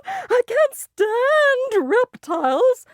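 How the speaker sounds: very high, slow, loud shriek; shrieking lady. A narrator's reading. Lady shrieks at the turtle held under her nose, very high. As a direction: shriek in horror at the reptile. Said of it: "Missus Yarby shrieked".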